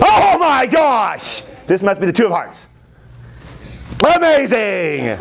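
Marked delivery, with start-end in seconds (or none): 0.0-1.4 drawn-out, high-pitched showman's exclamation; mock astonishment. Teacher marveling at own card trick, mock astonished. 4.2-5.2 slow, high-pitched showy exclamation; mock amazement. Teacher celebrating own card trick, hammed-up amazement.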